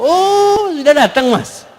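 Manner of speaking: very high, sing-out "oh"; distracted wife's greeting. Wife on her phone as husband arrives, high offhand greeting. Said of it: "Istrinya sambil main handphone".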